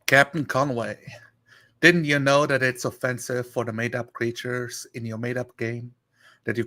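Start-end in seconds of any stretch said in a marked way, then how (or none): none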